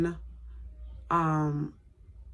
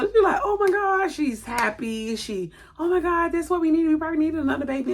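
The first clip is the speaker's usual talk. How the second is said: high, loud excited gush; 10 semitones higher, 3 dB louder than usual. Retold or imitated. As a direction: gush it like happy big sister. Sister excited about pregnancy.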